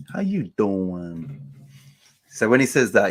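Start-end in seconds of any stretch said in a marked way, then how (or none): 0.1-1.4 drawn-out New York "How you doin'"; New York accent. Acted. Streamer imitating how a man greets Lee, slow New York accent. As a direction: stretch it slowly in a mock New York accent. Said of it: "his New York accent".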